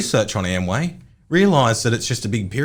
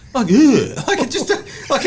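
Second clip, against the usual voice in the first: exasperated laugh; 12 semitones higher than usual. Real, not acted.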